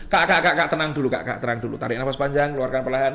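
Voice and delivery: low, soothing instructions; calming a patient. Acted. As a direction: settle an upset child down. Models calming a panicking child patient.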